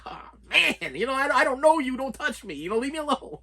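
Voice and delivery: half-whine to keep strangers away; annoyed inner voice. Retold or imitated. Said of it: "you're like".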